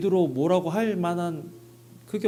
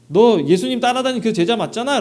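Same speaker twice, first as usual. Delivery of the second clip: loud, higher jab at Peter; sharp accusing shout; accusing challenge; 6 semitones higher, 8 dB louder than usual. Retold or imitated. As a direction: call him out as the disciple. Servant accuses Peter, acted quote.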